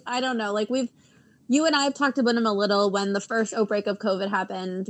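ordinary talk

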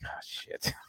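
breathy under-the-breath curse; whispered dread. Retold or imitated. Interviewer realising the boring fighter is next, whispered. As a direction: whisper it like you're dreading what comes. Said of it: "and I'm like".

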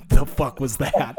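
laughing disbelief. Retold or imitated. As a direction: ask it while laughing. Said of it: "and I was like".

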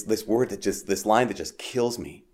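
ordinary talk, nothing marked

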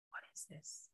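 awed hush without voice; whisper. Retold or imitated. Speaker recalls looking in Tony's eyes, awed whisper. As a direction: breathe it in awe.

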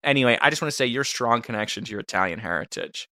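hushed, earnest gratitude; mock heartfelt. Producer thanks host for including him in her family, soft and sincere. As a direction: speak softly and low, humbly moved.

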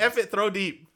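loud, high and eager; excited mock play call.

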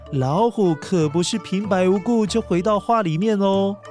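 usual speaking voice

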